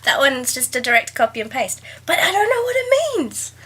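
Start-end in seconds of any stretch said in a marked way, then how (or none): 2.1-3.5 baffled squeak. Real, not acted. Laughing bafflement.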